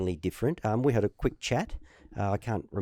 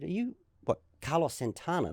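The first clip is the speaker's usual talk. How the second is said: higher, softer questioning of the name; retold disbelief; 7 semitones higher, 4 dB quieter than usual. Player reading the stranger's badge, disbelieving. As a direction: ask it as if you can't believe it.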